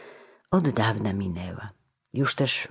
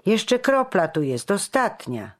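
high, louder call to a companion; 9 semitones higher, 5 dB louder than usual. A narrator's reading. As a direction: call your mate over, pitched up. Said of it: "zawołał, trącając towarzysza".

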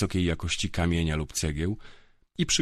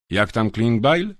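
slow, louder, higher probing question; casual question; 4 semitones higher, 7 dB louder, slower than usual. A narrator's reading. Inquisitor Grifo asking drunk guards about Klingbeil, slow probing. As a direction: ask lightly, with menace under it. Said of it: "zagadnął".

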